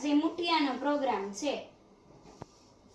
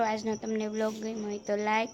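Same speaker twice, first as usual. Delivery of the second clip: normal talking voice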